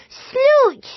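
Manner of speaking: put-on high, delighted baby shout; baby kicking her feet in delight; toddler shout. A narrator's reading.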